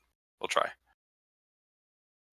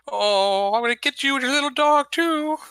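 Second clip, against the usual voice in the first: high cackling witch voice; 5 dB louder than usual. Acted. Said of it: "is cackling like".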